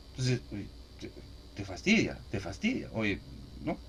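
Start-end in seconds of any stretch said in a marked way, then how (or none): none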